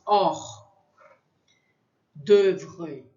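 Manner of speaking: "Hors d'oeuvre" in two separate parts, H silent; firm French "Hors", pause, then "d'oeuvre"; loud, separated French demo of "Hors d'oeuvre". Shown as a demonstration. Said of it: "não pronuncia".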